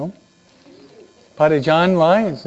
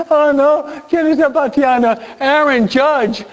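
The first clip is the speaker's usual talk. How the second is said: mock dread; 11 semitones higher, 4 dB louder than usual. Padre Lorenzo dreads Aaron Judge coming to bat, high mock panic. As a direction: cry out in fake alarm at the batter. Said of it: "Él estaba diciendo".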